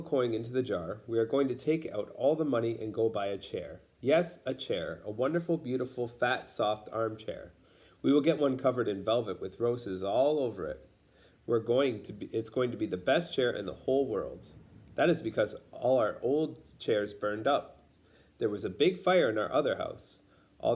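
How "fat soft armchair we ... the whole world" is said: ordinary delivery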